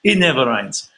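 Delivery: badly faked Portuguese accent; attempted Portuguese accent. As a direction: imitate a Portuguese local, accent going astray. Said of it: "Can't do a Portuguese accent".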